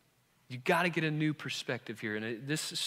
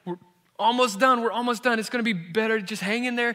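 put-on higher, louder, urgent comfort to a struggling boy; soothing a scared child; 8 semitones higher, 7 dB louder than usual. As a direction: hold on, soothe him through it. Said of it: "and I'm just like".

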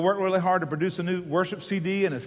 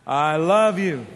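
slow, louder declaration of love; passionate; 6 dB louder, much slower than usual. Shown as a demonstration. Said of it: "With great passion, say it with me".

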